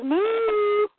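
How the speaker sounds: high, sustained cow moo; storyteller's playful cow noise. Acted. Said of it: "And Bessie goes".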